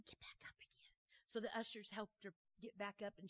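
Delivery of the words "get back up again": whispered command. Retold or imitated. Preacher tells lady to rise.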